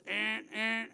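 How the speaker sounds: blaring alarm buzzer noises; imitated alarm. Worker surrounded by bells and whistles, imitated alarms. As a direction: mimic a repeating buzzer. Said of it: "alarms going on all over".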